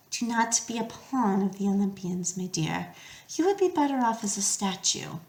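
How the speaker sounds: soft, measured monster's counsel; quietly discourage, as a remembered voice; Medusa's remembered words. A narrator's reading.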